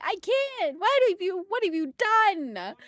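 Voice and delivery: high, hammed-up protest; mock exasperation. Streamer protests at the prank redeem, mock exasperated. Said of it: "No, I can't be angry!".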